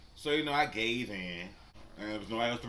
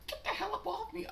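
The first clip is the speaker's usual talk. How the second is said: much higher mocking voice; Mary's protest mocked, much higher. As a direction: squeak it out as her. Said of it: "kinda like".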